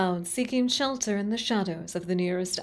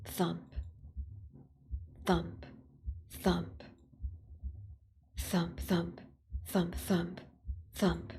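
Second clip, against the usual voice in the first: soft heartbeat thumps; 8 dB quieter, slower than usual. Acted. Voiced heartbeat sound effect.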